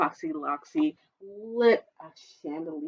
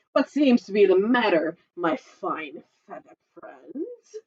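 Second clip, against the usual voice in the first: sly fox. Acted. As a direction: draw it out slyly like a fox. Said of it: "grinned Foxy Loxy".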